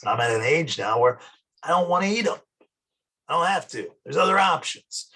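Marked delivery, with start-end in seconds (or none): none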